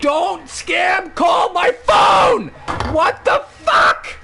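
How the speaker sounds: high, drawn-out hammed-up yell; outraged shout. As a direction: yell slowly at a scam caller. Acted yelling at scam callers.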